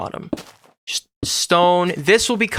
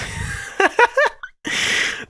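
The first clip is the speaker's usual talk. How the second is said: airy squeaky laugh; high wheezy laugh. Real, not acted.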